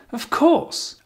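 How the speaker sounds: "Of course" extremely high, swinging up at the end; high pitch intonation. Shown as a demonstration. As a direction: exaggerate the friendly lift on "Of course". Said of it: "Can you hear the intonation?".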